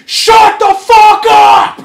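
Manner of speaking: furious yell. Retold or imitated. Yelling at chattering kids in the cinema, slow furious yell. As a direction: blast it across the theater. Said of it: "I literally just yell".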